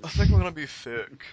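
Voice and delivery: lightly acted fake-sick line; imitated movie line. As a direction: deliver it like a bad actor. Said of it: "and says it just like that".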